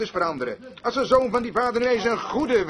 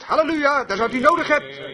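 raised, higher hallelujah; exclaimed praise; 5 semitones higher, 3 dB louder than usual. Retold or imitated. Retold preacher crying out as he reads, exclaimed praise. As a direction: cry it out with mild force. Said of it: "riep hij uit".

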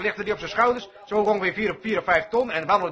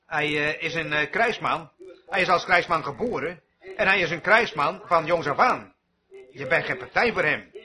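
lichtjes nagedaan bisschop; 5 semitones lower, 4 dB quieter than usual. Retold or imitated. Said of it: "de bisschop vertelde hem".

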